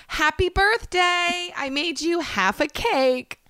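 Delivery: high, slow, sweet mock greeting; slow syrupy party voice; sing-song birthday greeting. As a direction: present the half cake with a drawn-out chirp. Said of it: "literally go".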